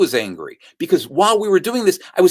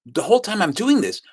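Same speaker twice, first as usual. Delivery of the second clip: usual speaking voice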